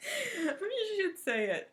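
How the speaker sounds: high, slow, laughing; laughing about her bad stitching. Real, not acted. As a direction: giggle it out slowly. Laughing describing result.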